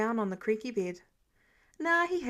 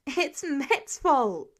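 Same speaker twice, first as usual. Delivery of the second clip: very slow, high, loud blaming yell; 4 semitones higher, 5 dB louder, much slower than usual. Acted. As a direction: shout it right back at him. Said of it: "she yelled at his incredulous face".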